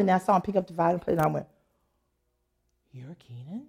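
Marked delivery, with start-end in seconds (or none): 2.9-3.6 very quiet, low question; hushed disbelief. Retold or imitated. Woman meeting the violinist she had only phoned, hushed disbelief. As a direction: retell it as an under-the-breath double take.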